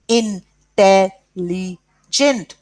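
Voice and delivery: Intelligent very slowly as "in... tel... li... gent"; split into syllables. Shown as a demonstration. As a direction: give Intelligent four separate, measured beats. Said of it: "four syllables".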